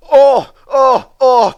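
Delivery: high, loud yelps of pain; van crying out. Acted. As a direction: cry out as the struck van. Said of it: "cried the van".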